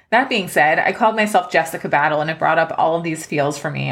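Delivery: ordinary delivery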